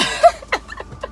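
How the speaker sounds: very high, breathless laughter; real laugh. Real, not acted. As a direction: lose it laughing. Laughing at her own awkward story.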